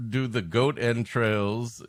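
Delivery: usual speaking voice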